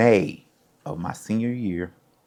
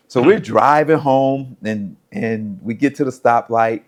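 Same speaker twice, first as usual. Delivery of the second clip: normal talking voice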